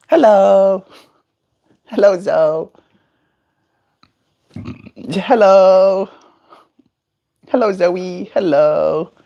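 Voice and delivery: high, bright-eyed coaxing voice for a bird; high sing-song coaxing of a pet; pet baby talk. Acted. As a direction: coo at the parrot to come over. Cooing at pet bird Zoe.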